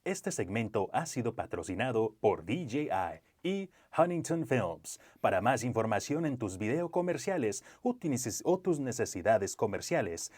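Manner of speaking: high, booming Spanish sponsor read; lucha libre announcer. Pretend. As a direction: hype the sponsor like a ring announcer. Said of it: "Close, close it out in Spanish if you can".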